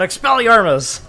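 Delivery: loud, high re-enacted yell of a spell. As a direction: yell it like a spell across a gym. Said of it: "I yelled out loud".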